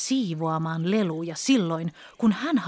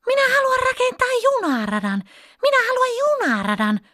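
put-on much higher, shrill child's tantrum shout; angry shout; 4 dB louder than usual. A narrator's reading. Pippa shouting at dad that she wants her train track, furious. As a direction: stamp out the words like a furious little girl. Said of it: "Pippa huusi vihaisesti".

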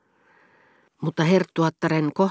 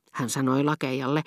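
usual speaking voice

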